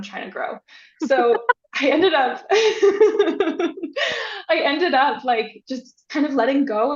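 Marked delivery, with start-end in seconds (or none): 2.5-4.4 laughs high at herself; high laughter. Real, not acted. Laughing at herself about her vision, a high burst of laughter. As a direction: giggle at her own story.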